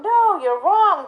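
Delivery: high, slow, firm reproach; scolding the dog. Retold or imitated. Trainer scolding dog for mistake, slow stern rebuke. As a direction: tell the dog off. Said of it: "But if I say to the dog".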